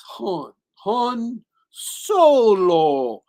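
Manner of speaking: hammed-up slow proclamation; mock-dramatic reveal. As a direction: stretch the surname like a grand, silly revelation. Mocks film's naming moment.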